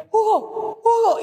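mother calling her son-in-law in panic, urgent call; alarmed calling out. Retold or imitated. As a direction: call his name in alarm.

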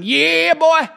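high, loud drawn-out whoop; whooping gunman. Acted. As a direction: holler it while blasting away at the woods. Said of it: "he unloads the entire clip at the woods, going".

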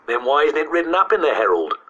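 high, challenging schoolboy question; demanding. A narrator's reading. Sceptical boy challenging the storyteller, demanding. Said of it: "demanded Jeff".

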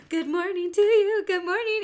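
high, loud sing-song good morning; cheery morning greeting. Retold or imitated. Mother greeting her sleepy daughter, high and chirpy. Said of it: "'cause I'll be like".

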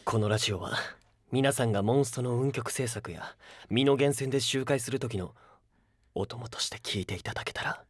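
very low late-night radio voice; deep, partly whispered radio intro; low intimate announcer. Acted.

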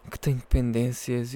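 ordinary talk, nothing marked

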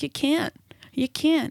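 hushed firm no; quiet refusal. Retold or imitated. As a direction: say it gently but flat out. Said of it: "be like".

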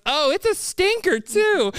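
put-on high, slow, sing-song tease of a pretty girl; girly teasing voice. Pretty classmate teasing him about the smell, high and slow.

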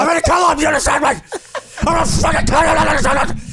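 high, strained trash-talk snarl; hammed-up boxer threatening his rival; menacing fighter threat. Mock fighter threat before match.

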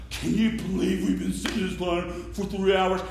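soft, fed-up grumble from the ground; complain, worn out by the line; tired complaint. Retold or imitated. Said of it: "They're like".

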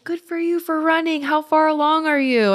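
high-pitched imitation of well-wishers; retold encouragement from passing runners; cheering runners.

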